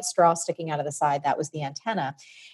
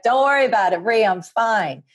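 a touch louder, slowing on "Rhea, I'm fine"; mild yell down; 5 semitones higher, 5 dB louder than usual. Retold or imitated. Mom calling down from the roof, mild breezy yell. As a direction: brush it off, calling down lightly. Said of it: "And she yelled down".

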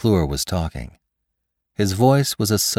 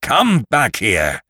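slow, drawn-out angry call, pitched up; angry command; 5 semitones higher, slower than usual. Acted. Lantry calls after his fleeing victim, angry command. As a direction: order him back, stretching each word.

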